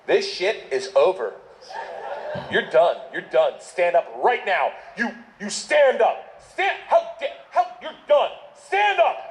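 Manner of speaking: self-righteous scolding, climbing high; liberal arts confidence. Acted. Angry chaperone ordering student up in train cabin, confident then flustered. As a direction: start firm and slow, build to a high sputter. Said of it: "liberal arts confidence".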